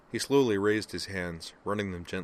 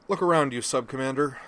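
bright and much louder, high with enthusiasm; big-hearted pep talk, pitched up high; expansive rallying call; 7 semitones higher, 8 dB louder than usual. Acted. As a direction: sweep her along with big cheer. Griffin expansively rallying T'Pol.

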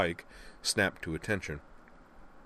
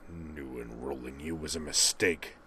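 slow grumbling growl; slow low resentful parting shot; 3 semitones lower than usual. Acted. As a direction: grudgingly growl the insult. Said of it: "a low growl of".

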